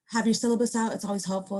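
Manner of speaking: usual speaking voice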